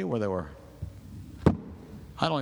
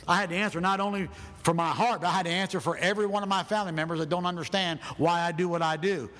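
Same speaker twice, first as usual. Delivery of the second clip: ordinary delivery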